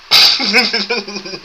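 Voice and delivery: loud high laugh; bright burst of laughter. Real, not acted. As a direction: laugh at your own rule. Laughing at own advice.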